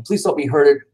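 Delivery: plain reading voice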